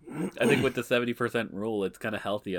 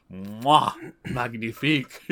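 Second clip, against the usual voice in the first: loud, hammy chef's kiss; hammy boisterous chef; 3 semitones higher, 7 dB louder, slower than usual. Retold or imitated. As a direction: play a boastful French chef. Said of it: "is like".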